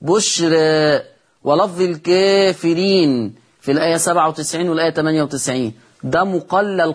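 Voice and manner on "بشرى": very slow بشرى with the final ألف tilted toward e; taqlil. Shown as a demonstration. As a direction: draw out بشرى, ending between a and e. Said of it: "مقلل لورش لفظ بشرى". (بشرى بالتقليل، ببطء شديد)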